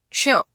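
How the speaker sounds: श said as "ksha", k running straight into sh; ksha sound. Shown as a demonstration. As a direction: start on k, release quickly into "sha". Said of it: "क बोलने के लिए तैयार हो". (क से तुरंत श, क्ष का उच्चारण)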